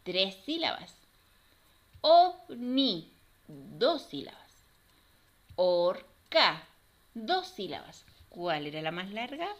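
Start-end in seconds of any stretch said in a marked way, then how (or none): 2.0-3.1 loud "Ov-ni" in two beats; teacher segmenting "Ovni" for children; syllable by syllable. Shown as a demonstration. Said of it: "vamos a separar cada una". 5.6-6.7 loud "Or-ca" in two beats; teacher segmenting "Orca" for children; syllable by syllable. Shown as a demonstration. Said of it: "vamos a separar cada una".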